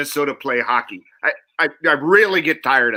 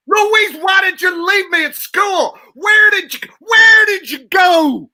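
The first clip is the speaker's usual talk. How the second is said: much higher, louder, near-crying yell; raging shout; 7 dB louder, slower than usual. Acted. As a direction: scream for Louise, furious and abandoned.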